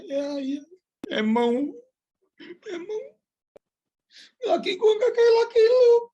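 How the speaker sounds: high, loud, crying woman's taunt; shrill wife boasting. Retold or imitated. Wife boasting to another wife, high crying shriek. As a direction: squeal it like a wife bragging about her husband. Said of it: "Jangan begini ngomongnya".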